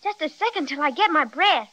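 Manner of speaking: usual speaking voice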